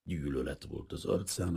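ordinary delivery, nothing marked

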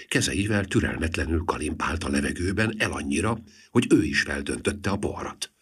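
usual speaking voice

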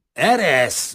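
Kazimír snaps at Rozita to let go; rough snarl. A narrator's reading. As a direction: shake her off roughly. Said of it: "Mordult rá Gorombán".

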